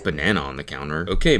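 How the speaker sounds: ordinary delivery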